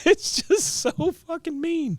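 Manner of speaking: high squeaky laughing speech; cracking up while talking; real laughter. Real, not acted. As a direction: barely get it out through laughter. Teller cracking up at the cruelty.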